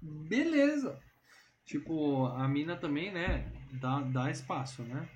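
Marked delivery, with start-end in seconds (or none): 0.0-1.0 high mock voice. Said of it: "E ela fala".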